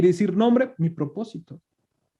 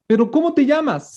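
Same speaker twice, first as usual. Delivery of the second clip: higher, insistent questioning as Moses; Moses pressing God; 6 semitones higher than usual. Retold or imitated. Moses pressing God for his name, higher and insistent. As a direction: push for the name like a puzzled Moses.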